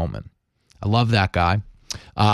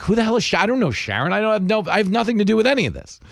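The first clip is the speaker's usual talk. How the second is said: higher, rushed flustered denial; flustered protest; 8 semitones higher, faster than usual. Retold or imitated. Host retelling his panicked reply to a threat, high and rushed. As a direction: talk yourself out of it, voice climbing. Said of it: "I'm like".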